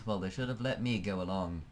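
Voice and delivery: usual speaking voice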